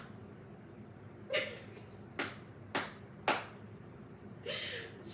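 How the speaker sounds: long, soft, breathless high laugh; helpless laughter. Real, not acted. Picturing her own mother's slap, real helpless laughter. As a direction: dissolve into wheezing laughter.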